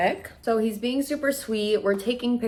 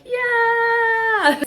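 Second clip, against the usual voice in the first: excited yes; 7 dB louder than usual. Retold or imitated. Answering hiker about engagement.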